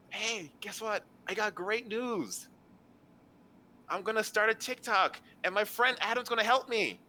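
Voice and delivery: high-pitched, bubbly burst of news; excited announcement. Acted. Meg runs up to share great news, excited. Game master voices Meg running up.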